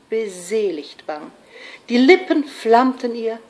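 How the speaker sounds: expressive verse, lifted phrase openings falling away; measured dramatic storytelling in verse; romantic poetry recitation. Acted. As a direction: recite with ardour, pausing between phrases.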